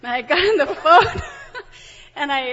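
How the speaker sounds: loud, laughing while telling. Real, not acted. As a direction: laugh through the words.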